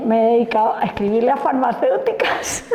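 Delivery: amused, breaking into a laugh at the end. Real, not acted. Speaker confessing her funding hunt to the audience, laughing. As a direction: say it on the edge of laughter.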